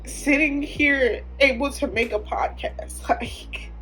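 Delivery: overwhelmed. Real, not acted. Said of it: "I'm overwhelmed with emotions".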